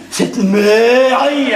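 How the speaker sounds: slow mock echo of contempt; mimicking scornful tone. Husband quoting his brother's slur on his wife, mimicking scorn. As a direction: imitate his disdain, stretching the name. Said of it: "sur ce ton-là".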